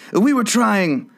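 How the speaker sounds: high, pleading excuse; humble servant's high voice. A narrator's reading. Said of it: "in a very humble tone".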